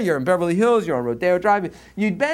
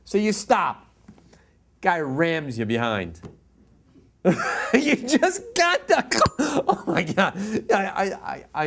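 ordinary talk, nothing marked

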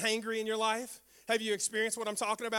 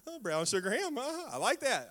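relishing. Retold or imitated. Relishing the ham.

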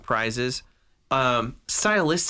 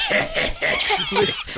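high, slow, laughing villain cackle; Raiden laugh impression.

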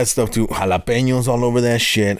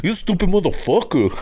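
higher, laughing put-on insult; a hint of the brother; imitating brother; 9 semitones higher than usual. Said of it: "talking to my brother".

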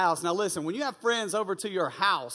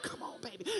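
sneaky background cheer; 12 semitones higher, 19 dB quieter than usual. Retold or imitated. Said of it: "I'm in the background like".

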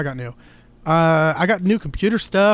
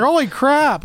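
surprised; 10 semitones higher, 6 dB louder, slower than usual. Retold or imitated. Astonished at ten-dollar TV.

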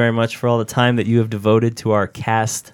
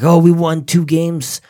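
mocking Astros player; 6 semitones higher, 3 dB louder than usual. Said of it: "come out and be like".

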